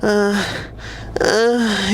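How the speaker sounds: mocking a guy stuck hesitating; mock hesitant moaning. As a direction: moan it out, stalling and unsure. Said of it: "you're over here moaning in the corner".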